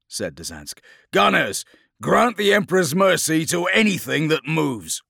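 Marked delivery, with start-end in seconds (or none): 1.1-5.0 loud, high, ringing command; rallying battle shout. Acted. Captain addressing gunners in battle, rallying shout. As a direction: rouse the gunners to slaughter.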